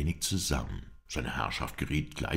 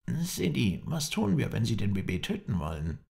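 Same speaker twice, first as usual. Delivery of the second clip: lightly voiced servant; 9 semitones higher, 3 dB louder than usual. A narrator's reading. Servant anxiously asks his master.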